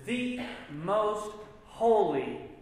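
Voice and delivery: holy drawn out slowly and pitched high; stress on holy. Shown as a demonstration. Teacher stressing God's holiness, slow and high. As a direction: stretch out the word holy. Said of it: "a superlative to say".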